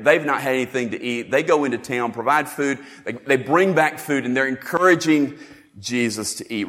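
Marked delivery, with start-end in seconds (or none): none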